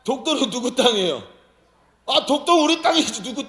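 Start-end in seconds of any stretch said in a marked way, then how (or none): 0.0-1.2 "독도는" hit hard and loud, falling at the end; louder prodding question. Real, not acted. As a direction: push the question again, louder, at the crowd. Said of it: "몰라?". (더 크게 다그치듯 묻기)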